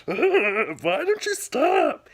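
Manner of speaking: put-on a frustrated four-year-old snapping at the toy; angry kid. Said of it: "I remember just being so angry".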